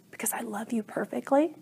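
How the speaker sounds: soft whispered voice of God; whispered divine reply. Retold or imitated. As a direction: whisper it lovingly.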